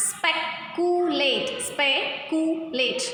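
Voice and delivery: "speculate" very slowly, in three separated parts; three-syllable demonstration. Shown as a demonstration. Teacher showing pupils three syllables, slow and segmented. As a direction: break "speculate" into spe, cu, late. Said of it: "three syllable words".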